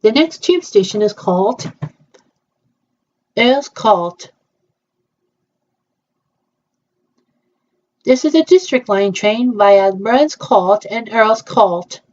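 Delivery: slow, even station announcement; train announcer. Pretend. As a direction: announce the stops like the Tube. Tube train announcement play.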